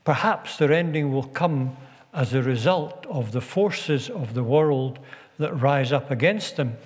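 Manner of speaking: ordinary talk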